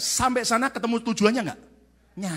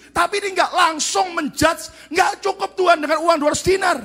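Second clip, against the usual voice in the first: 1 doubting disciple; 9 semitones higher, 6 dB louder than usual. Acted. Philip's doubting reply acted out.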